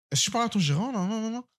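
high-pitched mocking imitation; mimicked complaining customer. Angry customer demanding the manager, mock high voice.

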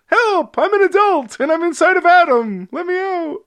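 lightly voiced trapped adult calling out; trapped inner adult. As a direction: cry for help like a tiny trapped person. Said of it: "the adult in me thinks".

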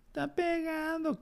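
put-on high, slow childish whine, a kid tattling; whiny kid voice. A kid complains to the teacher about being hit, in a high whine. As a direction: whine it like a little kid telling on a classmate.

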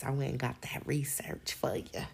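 hushed aside, breathy and unvoiced; whisper. Real, not acted. Whispering an aside to her listeners. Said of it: "aside to listeners".

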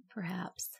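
breathy mysterious whisper. Pretend. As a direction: whisper it, spooky. Echoed in a whisper.

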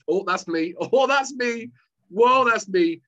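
like finding yourself on every page; excited self-recognition. Retold or imitated. Excited self-recognition reading book.

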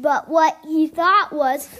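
ordinary delivery

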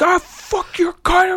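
high, slow whiny squeal; angry kid gamer mock. Online teammate insulting you, high bratty whine. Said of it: "You're like".

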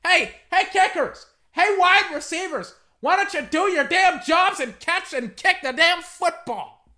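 loud, high-pitched rant at the players; angry yelling. Real, not acted. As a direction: yell at them to do their jobs. Yells at kickers and receivers.

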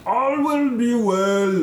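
slow, high Cockney blessing; drawn-out cult-like blessing; Cockney accent. Acted. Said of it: "doing this Cockney accent".

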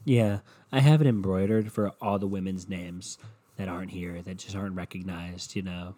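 low, soft smug imitation; imitated self-satisfied star, understated; mock smug. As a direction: murmur it like you know exactly what you're doing. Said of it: "She walks over all fucking smug".

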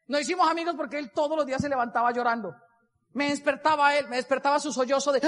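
ordinary talk, nothing marked

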